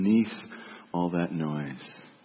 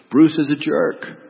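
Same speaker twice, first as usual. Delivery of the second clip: loud, raised burst of judgment; judging inner voice; 5 semitones higher, 9 dB louder than usual. Acted. Preacher voices the judgment we must silence, loud and insistent. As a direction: blurt it out loudly over everything else. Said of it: "silence that voice that says".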